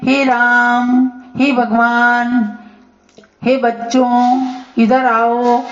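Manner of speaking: each vowel stretched slowly, as if calling from far away; long drawn calling. Shown as a demonstration. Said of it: "जैसे दूर से बुलाने में". (दूर से पुकारते हुए स्वर को खींचकर धीरे बोलना)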